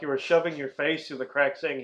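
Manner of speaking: normal talking voice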